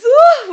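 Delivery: high, loud cheer; celebrating that her Sims made it to work; excited woo. Real, not acted. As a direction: whoop in triumph. Back at work.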